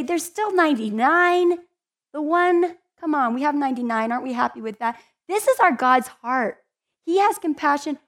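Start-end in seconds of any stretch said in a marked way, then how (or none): 0.0-5.0 higher, louder shrugging voice; mock dismissive. Preacher mimicking people content with ninety-nine, mock dismissive. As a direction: brush off the lost one.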